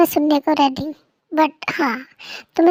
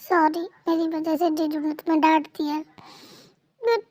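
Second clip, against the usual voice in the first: ordinary delivery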